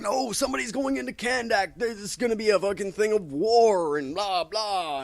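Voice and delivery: hammed-up prophecy of war; mock warning. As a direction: sound the alarm like a panicked hero. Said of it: "they're like".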